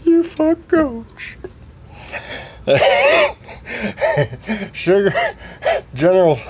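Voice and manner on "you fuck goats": very high, drawn-out cackling character; squealing mockery. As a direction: tease in falsetto, stretching the words. Voices Sugar Tits mocking Steve.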